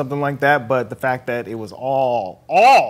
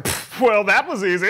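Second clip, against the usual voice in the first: lightly voiced smug Beck, a bit higher; smug villain; 6 semitones higher than usual. Retelling villain after the trick, smug. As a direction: scoff it off. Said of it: "and he's like".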